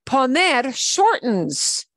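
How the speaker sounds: poner drawn out slowly with the stress on NER; slow, marked poner. Shown as a demonstration. Teacher singles out the verb, slow stressed poner. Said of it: "to an odd form".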